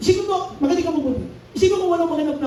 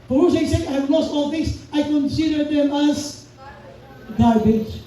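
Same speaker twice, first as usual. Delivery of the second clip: slow, deliberate quoting; slow scripture reading; 2 semitones higher, slower than usual. Shown as a demonstration. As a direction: draw each phrase out. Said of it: "Sabi niya". (mabagal na pagbasa ng Bibliya)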